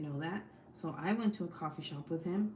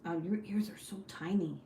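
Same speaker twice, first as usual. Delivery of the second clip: whisper. Real, not acted. Mother whispering to her baby about his tiny ears. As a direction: hush it, just breath.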